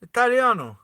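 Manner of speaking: high, loud Italian flourish on the word; mock Italian accent. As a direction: ham it up like an Italian. Playfully says it in Italian.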